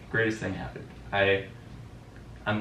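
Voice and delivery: normal talking voice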